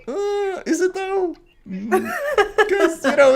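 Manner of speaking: high, whiny skeptical challenge; hammed-up disbelief; mock doubtful. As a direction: squeak it out as if doubting his own name. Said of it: "And I go".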